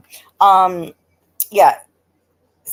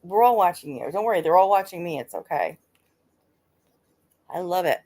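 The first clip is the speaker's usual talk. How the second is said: ordinary talk, nothing marked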